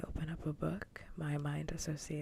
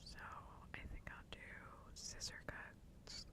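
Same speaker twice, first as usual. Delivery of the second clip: ASMR whisper; 12 dB quieter than usual. Real, not acted. Whispers plans for ASMR triggers.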